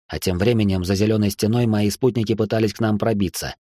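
usual speaking voice

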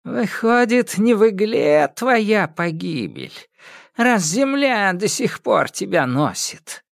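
high, slow mocking witch; taunting old witch. Яга дразнит Кощея. (насмешливая старая ведьма)

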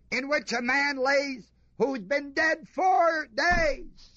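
rising sermon shout, drawn out; preaching shout. Acted. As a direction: hammer home the four days dead. Preacher builds to climax.

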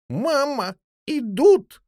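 put-on high, loud child's warning; alarmed cry. A narrator's reading. Young jackdaw warning mother from behind the door, crying out. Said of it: "закричал за дверью Голчонок".

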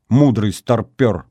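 plain reading voice

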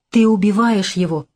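usual speaking voice

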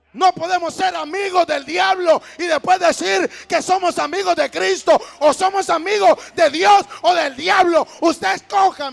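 high, shouted sermon challenge; full-force preaching. Real, not acted. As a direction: confront the congregation at full volume. (prédica desafiante, a gritos)

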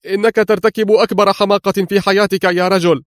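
high, outraged shout after someone; indignant cry. A narrator's reading. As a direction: call out in indignation. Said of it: "فهتف به جولييت في سخط".

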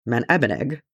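plain reading voice

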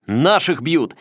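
loud rallying yell; battle cry. A narrator's reading. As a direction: shout it to rouse the crowd. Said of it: "С боевым кличем". (боевой клич)

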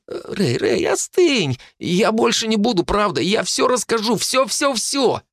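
high, frantic, squealing promises; boy yelping as his hand is crushed; panicked pleading. A narrator's reading. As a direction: babble in pain and panic. Phil panics under the grip.

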